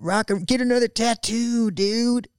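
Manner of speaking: high, dopey stoner-dude voice; terrible husband impression. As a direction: ham up a carefree rocker husband. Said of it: "such a terrible impression of your husband".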